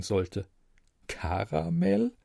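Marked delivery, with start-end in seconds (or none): 1.1-2.1 higher, soft, slow disbelieving question; can't believe that name; incredulous. A narrator's reading. Narrator mocking the angel's name, soft and incredulous.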